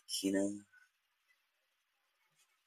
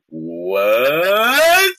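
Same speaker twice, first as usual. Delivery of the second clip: a sudden, louder, higher what of disbelief; stunned what; 5 semitones higher, 5 dB louder than usual. Retold or imitated. Accused of touching his girl, answering in stunned disbelief. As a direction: throw it out, floored by the accusation.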